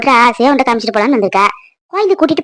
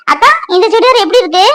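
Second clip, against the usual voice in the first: gushing show-off; 8 semitones higher than usual. Acted. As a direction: bounce with excitement for praise. Maha asks again, more eager.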